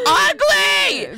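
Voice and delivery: 1 mock disgusted shout. Exaggerated disgust at her toe.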